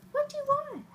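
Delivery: high squeaky question to the dog. Real, not acted. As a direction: chirp it up high. Asking dog.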